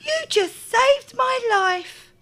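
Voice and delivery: put-on high, relieved girl's voice; grateful little girl. A narrator's reading. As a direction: thank him breathlessly.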